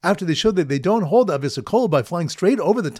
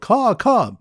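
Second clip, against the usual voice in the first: high, loud bird call; yelled caw; 6 semitones higher, 4 dB louder than usual. Retold or imitated. Bird watchers yelling at the birds, high loud caw. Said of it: "The bird watchers yelled".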